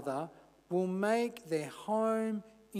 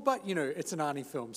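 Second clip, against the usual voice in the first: ordinary delivery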